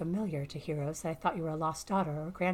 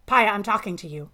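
much louder, sharp demand; angry demand; 3 semitones higher, 13 dB louder than usual. A narrator's reading. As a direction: make the ship answer you now. Angry owner demands an answer.

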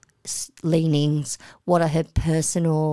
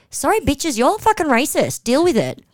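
defiant call-out; 7 semitones higher than usual. Retold or imitated. Voicing a defiant confrontation.

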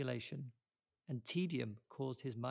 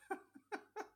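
high breathy giggle; 6 dB quieter than usual. Real, not acted. Laughs at own performance.

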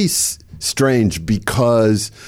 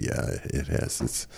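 quiet whispered agreement; a breathy hush to the director; hushed reply; 9 semitones lower, 13 dB quieter than usual. Retold or imitated. Whispering back to the funeral director, hushed.

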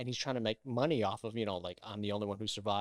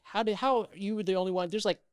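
raised-pitch disbelieving accusation; imitating an incredulous accuser; incredulous challenge; 7 semitones higher, 4 dB louder than usual. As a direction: question them in mock disbelief. Acts out suspicious people's challenge.

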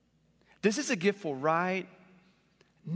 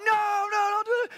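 high, loud cry of no, no; pleading shout to stop the kid; mock alarm; 4 dB louder than usual. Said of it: "And we're all like".

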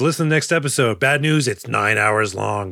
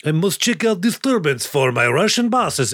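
put-on Russian henchman accent; 5 semitones higher than usual. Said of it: "you're like".

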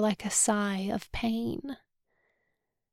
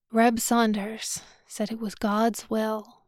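usual speaking voice

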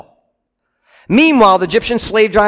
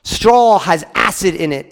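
normal talking voice

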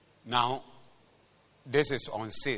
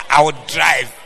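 mock bold shout; 11 dB louder than usual. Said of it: "It doesn't matter".

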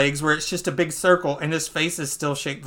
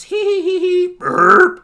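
high mock giggle into a burp; 13 semitones higher, 8 dB louder, slower than usual. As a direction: giggle high, then fake a loud burp. Imitating Luffy giggling, then burping.

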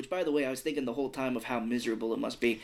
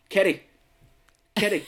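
loud, higher calls of a name, slurred; Morgan Freeman impression; 8 semitones higher, 9 dB louder than usual. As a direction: call out the name loudly as the film character. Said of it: "Morgan Freeman's like".

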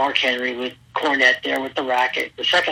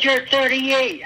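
imitating Cornette; 10 semitones higher, slower than usual. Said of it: "He said".

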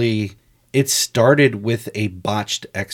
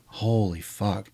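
stunned; 7 dB quieter, slower than usual. Retold or imitated. Stunned reaction to friend giving up.

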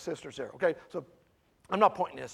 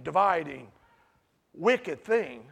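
ordinary talk

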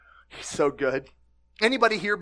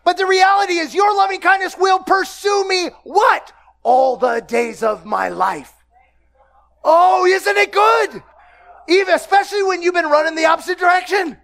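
louder, higher, shouting preacher; full-force preaching; 7 semitones higher, 4 dB louder than usual. Acted. Pastor shouts the psalm's promise to the congregation. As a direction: preach it out to the room.

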